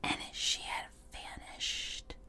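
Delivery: breathless, slow ghost-story whisper; eerie whisper. Acted. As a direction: drop to a whisper for the reveal. Spooky reveal, whispered.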